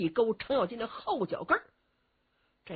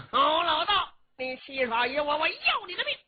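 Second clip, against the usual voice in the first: high, loud raging yell at the priest; furious outburst; 8 semitones higher, 3 dB louder than usual. Acted. Cheng Yaojin, tricked by the old priest, furious yell. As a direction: shout it like a brawler who's been tricked. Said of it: "程咬金气坏了".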